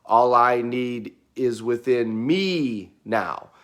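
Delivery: "me" stressed, slow and falling at the end. Shown as a demonstration. Coach repeating the incantation, slow stress on "me". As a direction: lean on the word "me", slow and deliberate. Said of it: "we emphasize a different word".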